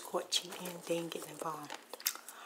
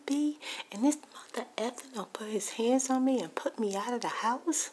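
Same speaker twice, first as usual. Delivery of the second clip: high, loud imitation of an angry friend; retold heated rant, pitched up; indignant confrontation; 4 semitones higher, 4 dB louder than usual. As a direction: act out her outraged demand, voice lifted. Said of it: "it went from crying to".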